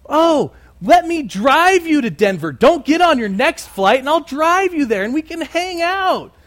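high, gushing imitation of a pushy seatmate; overeager offer. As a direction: gush it brightly, pitch climbing to the end. Acted overeager woman offering a ride.

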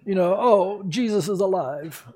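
a lightly voiced valley girl gushing; a hint of breezy valley girl squeal. Preacher mocking a careless believer, light valley girl gush. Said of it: "He's not like some valley girl".

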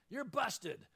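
God as an arresting officer catching Adam; police officer. Retold or imitated. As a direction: nail him like a cop. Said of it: "as an arresting police officer".

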